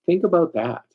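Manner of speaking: ordinary talk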